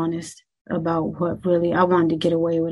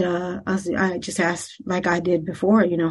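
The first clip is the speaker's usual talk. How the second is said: normal talking voice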